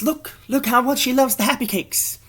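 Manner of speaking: very high squealed ad voice; excited pitchman. Acted. Top Hat Man selling Happy Cakes, high and excited. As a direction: pitch it way up high, showing him off.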